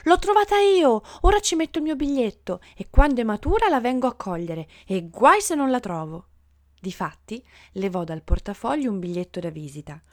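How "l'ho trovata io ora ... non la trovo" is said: high, loud, excited claim; triumphant shout. A narrator's reading. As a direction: cry out proudly like the first finder. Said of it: "e gridava".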